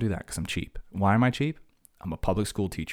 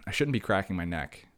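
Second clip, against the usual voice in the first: ordinary talk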